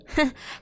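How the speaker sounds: light teasing giggle; a quick playful laugh. Acted. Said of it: "I laughed playfully".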